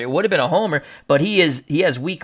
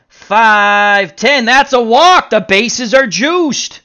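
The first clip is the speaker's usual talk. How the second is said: loud, raised call stretching at the end; excited play-by-play; 7 semitones higher, 7 dB louder, slower than usual. Pretend. Announcer calls the walk that loads the bases, excited. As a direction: punch out the call with rising excitement.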